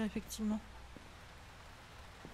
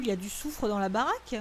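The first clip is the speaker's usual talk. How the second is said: ordinary talk, nothing marked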